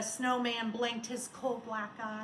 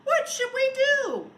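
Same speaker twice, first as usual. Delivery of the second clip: put-on high, loud worried children; children crying out; 11 semitones higher, 11 dB louder than usual. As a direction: call out like scared kids. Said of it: "The children cried out".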